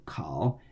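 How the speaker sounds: "烤" dropped low and soft; third tone. Shown as a demonstration. Teacher models the syllable for learners, third tone. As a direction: sink down low on "烤", Mandarin third tone. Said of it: "in the third tone".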